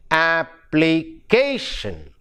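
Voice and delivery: "application" slowly, syllable by syllable, weight on "ca"; slow ap-pli-CA-tion; stress on penultimate syllable. Shown as a demonstration. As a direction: break up "application" and stretch the "ca". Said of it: "the stress is on the penultimate syllable".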